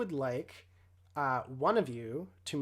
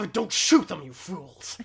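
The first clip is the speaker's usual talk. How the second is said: commanding villain shout; 2 semitones higher, 9 dB louder than usual. Acted. Villain Leland commands henchmen.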